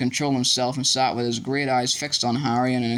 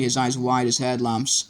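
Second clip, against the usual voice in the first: ordinary delivery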